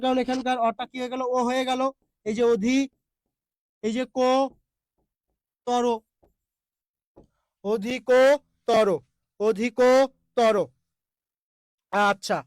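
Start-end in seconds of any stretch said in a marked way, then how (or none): 2.2-5.8 অধি, ক, তর as three separate pieces with long pauses; syllable-by-syllable breakdown. Shown as a demonstration. Teacher dictating proper pronunciation, অধি, ক, তর piece by piece. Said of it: "proper উচ্চারণটা এইটা ভাইয়া". 7.6-10.6 অধিকতর as "odhi-KOOO... toro", very slow; slow pronunciation demo. Shown as a demonstration. Teacher drilling proper pronunciation, অধিকতর slow with long ko. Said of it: "proper উচ্চারণটা এইটা ভাইয়া".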